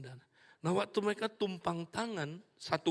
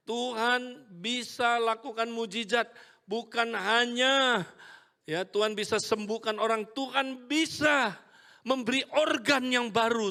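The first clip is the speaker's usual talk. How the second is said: louder and higher, bold proclamation; preaching boldly; 5 semitones higher, 6 dB louder than usual. Retold or imitated. Preacher telling cell group God can heal, loud and bold. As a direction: declare it like a prophecy. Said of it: "saya bilang".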